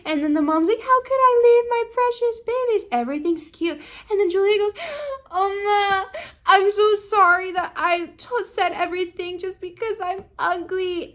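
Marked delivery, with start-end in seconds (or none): 0.8-2.9 high-pitched tearful mom; hammed-up high wail; mock wailing. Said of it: "are on the floor crying". 5.3-11.0 high-pitched mock crying; hammed-up, whimpering high voice; tearful apology. Julia's tearful apology to mom.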